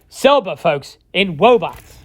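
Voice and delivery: lisping cartoon hunter voice; Elmer Fudd. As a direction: swap r and l sounds for w, cartoon style. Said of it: "as Elmer Fudd would say".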